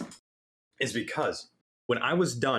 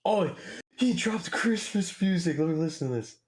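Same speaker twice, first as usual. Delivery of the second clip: excited discovery; 8 semitones higher than usual. Real, not acted. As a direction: react high and thrilled to a find. Discovers a new Christmas release.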